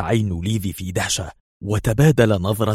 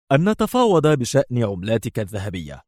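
ordinary delivery, nothing marked

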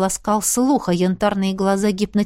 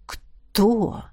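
insinuating purr. A narrator's reading. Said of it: "вкрадчиво мурлыкнул".

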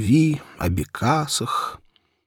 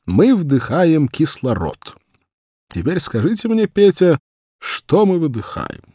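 рассеянная гувернантка; 7 semitones higher, 3 dB louder than usual. A narrator's reading. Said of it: "Зиночка рассеянно глядела в окно и говорила".

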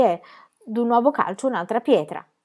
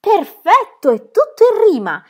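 much higher and louder cheer; delighted; 12 semitones higher, 9 dB louder than usual. Acted. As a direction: burst out with delight. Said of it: "esclamò Pippi compiaciuta".

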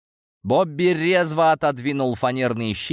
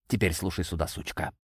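quiet menace; 5 semitones lower, 7 dB quieter than usual. Acted. Abuser threatens Edna quietly.

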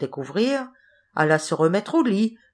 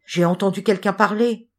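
ordinary delivery

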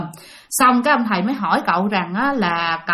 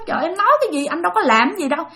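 mock innocent denial; 7 semitones higher, much faster than usual. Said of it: "Thì cậu nói".

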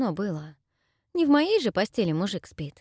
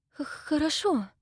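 higher, stammering in fright; frightened stammer; 10 semitones higher than usual. Acted. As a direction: stutter out a scared yes. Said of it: "Дайра испугалась". (испуганное заикание)

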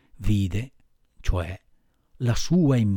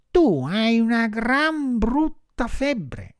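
high, slow marmot voice; high-pitched animal doctor; grave little marmot; 10 semitones higher, slower than usual. A narrator's reading. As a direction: deliver a diagnosis in a drawn-out high voice.

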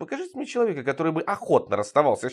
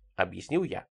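plain reading voice

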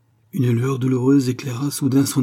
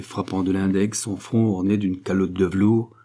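ordinary delivery, nothing marked

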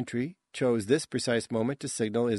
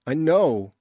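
louder, firm over her pain; louder pained comfort; 4 semitones higher, 7 dB louder than usual. Acted. Jarek soothes screaming Mary while splinting her arm, louder comfort. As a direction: hold her through the scream. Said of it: "Mary screamed as he tenderly".

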